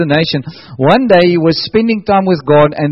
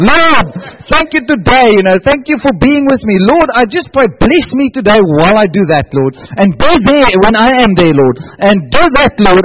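mock bossy prayer; 5 semitones higher than usual. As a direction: pray it up high, like giving God a list of orders. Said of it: "everybody's beginning to think, how do I pray".

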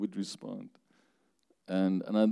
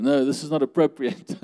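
scolding crowd; 5 semitones higher, 6 dB louder than usual. Acted. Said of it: "Everybody tell him".

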